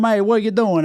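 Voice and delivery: a touch of an Italian pizza guy; pizza man voice. Shown as a demonstration. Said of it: "talking like this to him".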